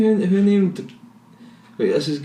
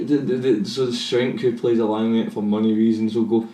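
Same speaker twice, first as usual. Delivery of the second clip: normal talking voice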